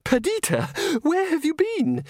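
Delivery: Agnes hissing high and urgent; sharp, high hissed question. A narrator's reading. Agnes hisses at Christine, high and urgent. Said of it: "she hissed".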